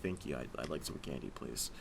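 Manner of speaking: very low, hushed deadpan; Jake from State Farm. Acted. Costumed guest asking for candy, low character voice. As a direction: keep it down in a low, polite monotone. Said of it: "that's how I talked the whole time".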